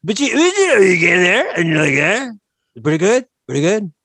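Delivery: boastful rambling Trump impression. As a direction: ramble like Trump, full of himself. S0 holds Trump voice.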